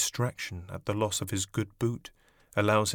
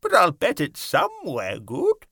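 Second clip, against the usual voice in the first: grasshopper voice; 8 semitones higher, 6 dB louder than usual. A narrator's reading. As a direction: shrug it off like a bug. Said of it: "the old green grasshopper said".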